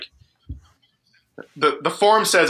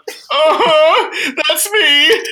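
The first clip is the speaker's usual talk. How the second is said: very high, loud squeak; hammed-up high-pitched confession; sheepish admission; 12 semitones higher, 5 dB louder, much slower than usual. Sheepish confession to federal marshals, acted.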